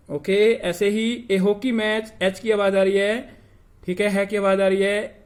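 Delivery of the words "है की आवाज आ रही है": ordinary delivery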